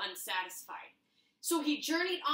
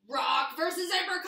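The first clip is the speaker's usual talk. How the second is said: much higher, louder arena shout; fight announcer shout; 6 semitones higher, 7 dB louder than usual. Acted. Announcing Rock versus Apricot to the crowd, shouting. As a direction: roar it like a ring announcer.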